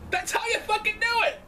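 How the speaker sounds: high, stronger thrilled cry; excited exclaim. Real, not acted.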